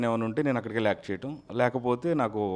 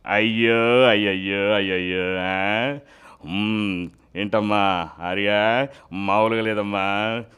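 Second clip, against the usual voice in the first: Venkatesh impression; 4 dB louder, slower than usual. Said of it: "ఇప్పుడు victory Venkatesh గారు".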